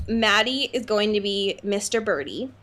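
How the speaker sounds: ordinary delivery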